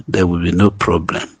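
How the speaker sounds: ordinary talk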